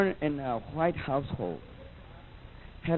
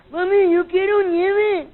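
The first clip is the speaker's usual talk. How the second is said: much higher, louder, slow pleading; whiny Mexican kid; 12 semitones higher, 5 dB louder, slower than usual. Retold or imitated. Mexican kid begs mom for ice cream, high and slow. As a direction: beg mami for ice cream, drawn out and high.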